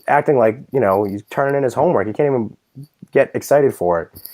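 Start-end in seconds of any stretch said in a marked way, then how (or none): none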